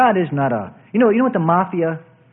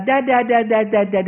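put-on pestering child; 4 semitones higher than usual. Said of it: "the little baby goes".

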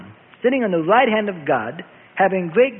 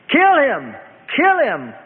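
high, loud cry of rage; enraged scream; 6 semitones higher, 4 dB louder, slower than usual. A narrator's reading. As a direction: scream it in short furious bursts. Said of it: "Caiaphas screamed in rage".

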